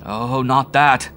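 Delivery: loud, slow, anguished cry; desperate cry to Howard; cried out. A narrator's reading. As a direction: cry out in dread. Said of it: "I cried".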